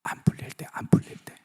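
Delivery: soft unvoiced whisper. Pastor answering his own question in a whisper. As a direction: breathe the answer.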